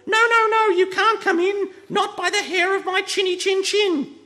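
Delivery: very high, loud squeaky pigs with a laugh. A narrator's reading. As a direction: pigs giggling behind the door. Three pigs call out, laughing.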